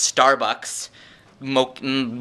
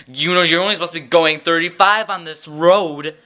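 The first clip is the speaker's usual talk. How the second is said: higher, louder imitation of parents lecturing; nagging parents; 4 semitones higher, 4 dB louder than usual. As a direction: mimic the parents scolding from the back seat. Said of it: "they're gonna be like".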